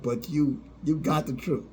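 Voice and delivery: ordinary delivery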